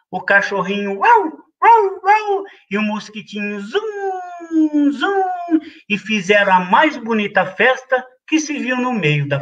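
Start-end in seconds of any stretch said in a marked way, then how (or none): 1.6-2.4 high playful woof; dog barking. A narrator's reading. Puppy joining the party, high bark. 3.6-5.6 high buzzing zum; mosquito buzz. A narrator's reading. Mosquito buzzing in the goat's ear, high buzz.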